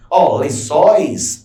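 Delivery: ordinary delivery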